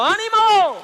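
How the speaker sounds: shouted call to the crowd. Shown as a demonstration. Preacher leading the crowd in a shout of encouragement. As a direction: cry it out loud to the congregation. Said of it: "grita conmigo".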